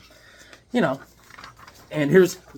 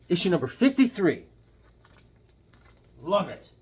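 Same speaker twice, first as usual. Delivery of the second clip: usual speaking voice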